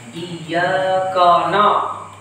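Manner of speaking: the na of Iyyaka na said thick and heavy, very slow; heavy nun. Shown as a demonstration. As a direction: carry the heavy sound over onto the nun. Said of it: "menebalkan huruf nun". (nun pada na dibaca tebal)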